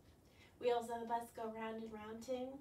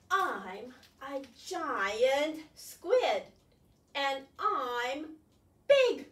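big, slow, booming squid; slow loud boastful squid; giant squid voice; 8 dB louder, much slower than usual. Acted. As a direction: boom it out like a proud giant squid.

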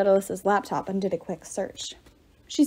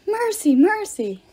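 begging for mercy; 10 semitones higher, slower than usual. A narrator's reading. Ghost cringes, begging.

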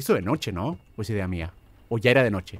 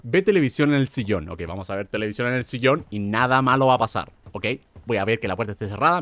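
usual speaking voice

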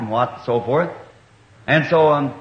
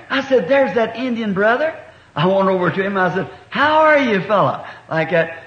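put-on higher, bright hail of an old friend; cheery greeting; 6 semitones higher than usual. Preacher greeting the Indian brother, delighted. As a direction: call out happily to him. Greeting the chief after months.

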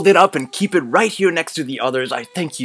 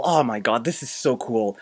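normal talking voice

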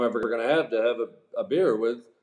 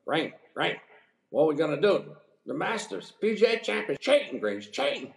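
put-on frantic Southern accent squeak, higher and higher; panicked Southern accent; 6 semitones higher than usual. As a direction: imitate a rattled Carolina friend, voice climbing in Southern accent. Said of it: "So he's got this Southern accent, and he goes".

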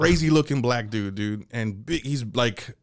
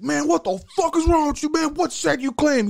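inmate bully. Retold or imitated. Inmate scolding kid.

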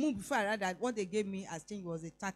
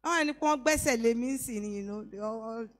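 joking; 3 semitones higher, 6 dB louder than usual. Retold or imitated. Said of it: "I just said jokingly".

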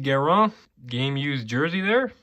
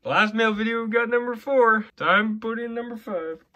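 higher, slow and drawn out with delight; thrilled; 7 semitones higher, slower than usual. Real, not acted. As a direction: savour the moment. Excited over Angry Canes guy.

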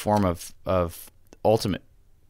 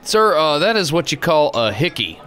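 high bartender voice; 8 semitones higher, 4 dB louder than usual. Pretend. Bartender character replies.

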